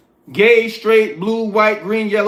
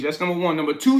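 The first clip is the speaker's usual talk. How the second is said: ordinary delivery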